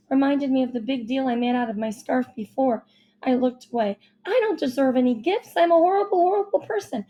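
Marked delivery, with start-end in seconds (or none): 4.2-7.0 self-reproach. A narrator's reading. Maggie feels terrible about gifts.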